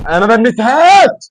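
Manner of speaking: loud, high, slowed protest; indignant shout. Real, not acted. Gamer protesting to teammates, loud high indignant shout. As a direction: yell your innocence. Accused in co-op game.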